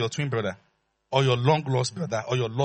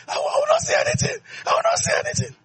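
sulky king. Acted. King refusing to speak.

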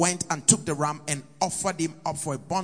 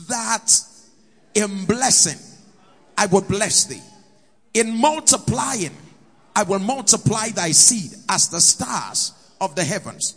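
raised, fervent preaching voice; 4 semitones higher, 4 dB louder than usual. Acted. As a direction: proclaim it from the pulpit. Preacher proclaims the promise.

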